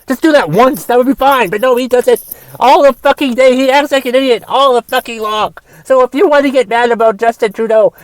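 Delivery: high, loud, escalating tirade; heated rant. Real, not acted. Host turning his rant on a listener, high and loud. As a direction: build into a full blown rant.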